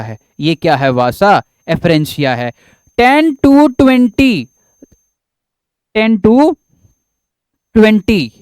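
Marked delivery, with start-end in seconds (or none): 3.0-8.3 ten to twenty said high and slow; teacher stressing टेन to twenty; drawn-out stress. Shown as a demonstration.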